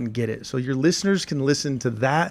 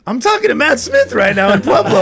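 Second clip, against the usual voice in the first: louder, higher amazed report; excited retelling; 11 semitones higher, 8 dB louder than usual. Retold or imitated. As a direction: tell it like big news. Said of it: "And Tim's like".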